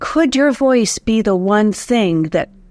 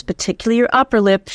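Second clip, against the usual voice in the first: normal talking voice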